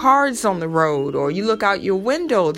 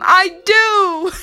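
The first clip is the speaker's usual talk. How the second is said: much higher, louder joyful I do; excited scream; 10 semitones higher, 5 dB louder than usual. Retold or imitated. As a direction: shout it out with joy. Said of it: "And she screamed".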